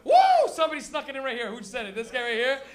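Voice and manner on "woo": high, sudden "woo" shout; loud whoop. Real, not acted. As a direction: let out a big stage whoop. Comic whoops at the audience.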